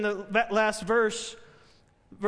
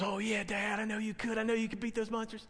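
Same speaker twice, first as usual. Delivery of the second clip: softly voiced small boy; hushed, lightly acted kid; little son; 2 semitones lower, 9 dB quieter than usual. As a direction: imitate a child quietly reassuring Dad. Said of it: "And his son goes".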